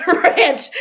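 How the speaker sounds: laughing out loud, high and loud. Real, not acted. Preacher telling the Anahuac punchline, laughing. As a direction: laugh through the word.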